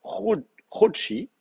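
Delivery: Chou- then Chouchi, very slow and broken apart; teacher slowly spelling out Chouchi; syllable by syllable. Shown as a demonstration. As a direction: break Chouchi into its syllables for students. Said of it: "vous pouvez mettre comme ça".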